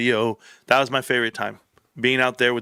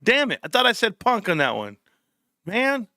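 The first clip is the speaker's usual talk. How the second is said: high frustrated groan at a mistake; frustrated whine; 9 semitones higher than usual. Real, not acted. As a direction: whine it, annoyed at yourself. Annoyed at game mistake.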